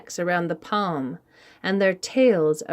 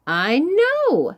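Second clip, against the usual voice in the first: emphatic; 8 semitones higher, 4 dB louder than usual. Acted. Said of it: "said Koi".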